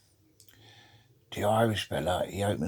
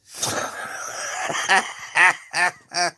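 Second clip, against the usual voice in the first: high, loud burst of laughter; real laughing fit; 11 semitones higher, 7 dB louder than usual. Real, not acted. Joker laughing at his own punchline, high helpless laughter. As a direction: crack up helplessly at your own joke.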